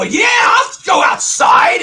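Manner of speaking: loud, high, drawn-out bellow. Retold or imitated. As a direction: bellow it slowly for everyone to hear. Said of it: "got really loud in my bellowing voice".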